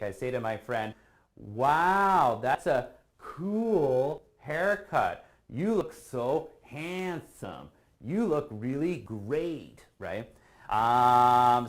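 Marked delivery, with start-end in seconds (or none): 1.4-9.9 slow, drawn-out mock praise; sarcastic. Teacher fakes praising a friend's bad haircut, slow and sarcastic. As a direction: stretch the compliment so it drips irony. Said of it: "I decide to be sarcastic".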